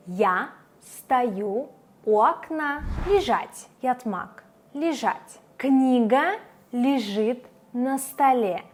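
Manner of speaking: normal talking voice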